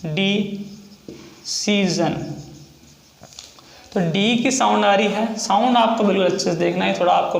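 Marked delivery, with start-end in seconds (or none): 0.0-2.3 decision drawn out very slow and soft; slow dictation. Shown as a demonstration. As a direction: spell out decision slowly like dictation. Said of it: "पहला example है".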